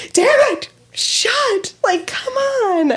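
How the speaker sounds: high-pitched, hammed-up frustration; retold exasperation. Mom reenacting her fight with the van door, retold exasperation. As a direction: exclaim it in comic exasperation.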